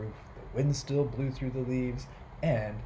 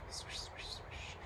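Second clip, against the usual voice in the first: whispered swish; 18 dB quieter, slower than usual. Acted. Peter voicing falling leaves.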